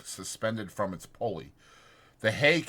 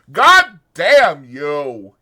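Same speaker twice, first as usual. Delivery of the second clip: loud, slow, furious cry; angry cry; 5 semitones higher, 15 dB louder, much slower than usual. A narrator's reading. Lenny curses the dead puppy, loud and furious. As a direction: curse the puppy in sudden anger. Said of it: "he cried".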